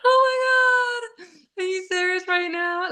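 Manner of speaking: retold as melting with astonishment; moved disbelief. Mom reacting to son wanting a hug, high moved disbelief.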